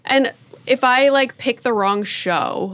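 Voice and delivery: normal talking voice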